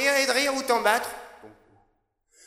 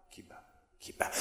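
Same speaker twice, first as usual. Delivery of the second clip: whispered echo of the line; soft unvoiced echo; 19 dB quieter than usual. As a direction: breathe it like a heartbeat.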